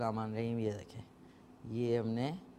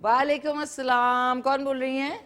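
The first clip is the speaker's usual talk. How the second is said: louder and higher, calling down a faint line; speaking up on the phone; 6 semitones higher, 4 dB louder than usual. Real, not acted. Host answering a faint live caller, louder and higher. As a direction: raise your voice so the caller can hear. ذرا اونچا بولیں.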